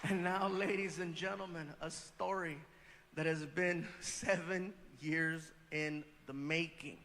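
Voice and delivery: host intro. Acted. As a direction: set up the big reveal. Host introduction, lower and slow.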